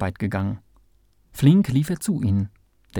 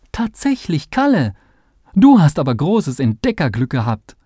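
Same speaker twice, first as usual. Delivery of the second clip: much higher, bright and louder cry of praise; delighted praise; 6 semitones higher, 6 dB louder than usual. A narrator's reading. The professor praises Kalle's find, high and delighted. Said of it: "lobte Sandkörnchen".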